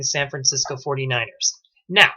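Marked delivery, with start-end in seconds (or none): none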